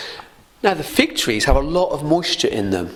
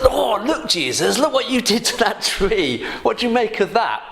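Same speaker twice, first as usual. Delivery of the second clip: a lightly voiced Peter, higher and amazed; 8 semitones higher than usual. Peter pointing out the dead fig tree to Jesus, higher and amazed. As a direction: pitch Peter up, eager and wondering.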